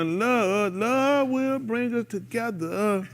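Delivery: high, slow, drawn-out sermon line; preachy sermon voice. Retold or imitated. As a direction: preach it slow like a loving pastor. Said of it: "I said".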